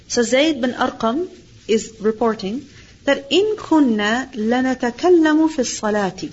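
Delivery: ordinary delivery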